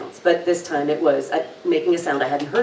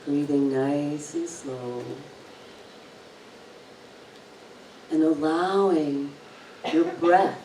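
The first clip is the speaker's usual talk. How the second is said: slow, soft meditation voice; guided meditation; 4 dB quieter, slower than usual. Acted. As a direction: guide the listener into calm, slowly.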